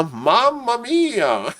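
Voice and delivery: drawn-out, high-pitched mamma mia; mock-dramatic exclamation. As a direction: stretch it out slowly in playful, sing-song dismay. Mock Italian exclamation in game commentary.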